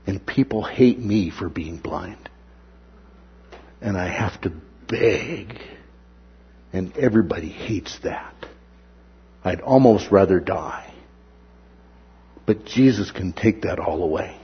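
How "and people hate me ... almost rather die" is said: low, hushed despairing inner voice; a broken man's private thought, quietly; soft, lower-voiced beggar lament; voicing the blind man. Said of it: "he's been thinking".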